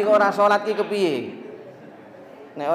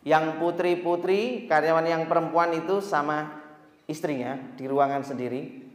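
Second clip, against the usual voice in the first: ordinary talk, nothing marked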